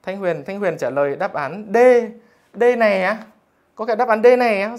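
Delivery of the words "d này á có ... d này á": loud, higher, disbelieving exclamation; can't believe someone picked D; incredulous surprise. Real, not acted. Teacher to joking student, loud and disbelieving. Teacher sees a joke answer.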